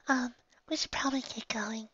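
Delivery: high, soft nervous whisper. Acted. Firefly urging the group to leave, whispered nervously. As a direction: breathe it out afraid of being heard. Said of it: "Firefly said nervously".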